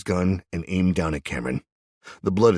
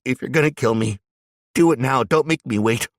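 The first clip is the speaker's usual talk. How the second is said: desperate plea; 9 semitones higher, 8 dB louder than usual. Acted. Cameron resigned to die.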